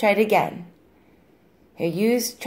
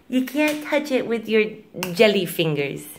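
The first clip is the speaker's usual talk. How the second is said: ordinary talk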